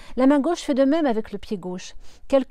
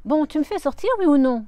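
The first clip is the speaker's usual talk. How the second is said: loud, impatient demand; 5 semitones higher, 7 dB louder than usual. Acted. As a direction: push him for an answer. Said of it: "s'impatiente-t-elle". (impatiente, plus fort)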